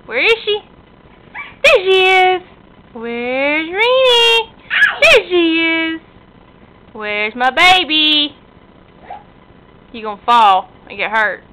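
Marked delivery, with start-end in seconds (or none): 0.1-2.4 high, loud peekaboo reveal; excited peekaboo. Acted. Peekaboo with toddler. 2.9-4.5 high, slow, loud playful call; singsong peekaboo. Acted. Peekaboo with toddler. 5.0-8.4 put-on slow, high, loud baby talk; excited peekaboo. Peekaboo with toddler.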